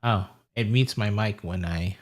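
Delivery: ordinary talk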